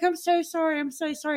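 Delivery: high-pitched, repeated mock apology; retold apologising. Client apologising to therapist for crying, retold apology. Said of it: "I would always apologize to him".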